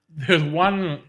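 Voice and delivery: ordinary talk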